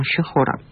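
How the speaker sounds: "шехора" in three syllables, e after ш; подвижная шва. Shown as a demonstration. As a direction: voice the first shva in «шехора». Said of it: "произносилась бы шва на". («шехора» со слышным «е» после «ш»)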